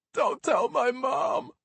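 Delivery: put-on high, slow wailing like a toddler; crying shout. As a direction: blubber it like a child caught. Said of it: "he cried".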